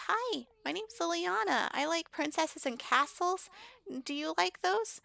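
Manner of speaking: put-on very high, sweet-innocent child voice; little kid. Liliana greets the strange man, childlike. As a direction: introduce yourself like a small child. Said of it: "she's a kid".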